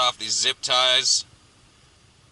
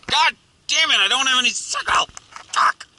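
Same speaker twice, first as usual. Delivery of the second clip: real angry shout, much higher and louder; frustrated outburst; 11 semitones higher, 4 dB louder than usual. Real, not acted. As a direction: blurt it out, fed up with the broken radio. Frustrated outburst, no signal found.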